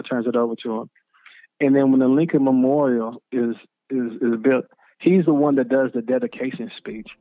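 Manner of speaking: ordinary delivery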